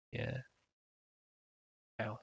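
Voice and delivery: ordinary talk, nothing marked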